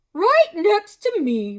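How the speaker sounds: high, loud, gushing hostess; Missus Moose voice. Acted. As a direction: gush at him, high and bright.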